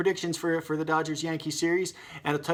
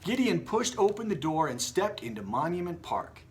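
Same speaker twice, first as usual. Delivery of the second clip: plain reading voice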